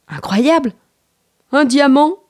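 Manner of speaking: lightly acted wonder, lifting in pitch; amazed exclamation. Boy spots the sparkling stone, mildly acted amazement. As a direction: exclaim at an astonishing find. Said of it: "s'exclama Petit garçon".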